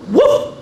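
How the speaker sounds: high, loud woof; short imitated bark; puppy's woof. A narrator's reading. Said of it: "says the little puppy".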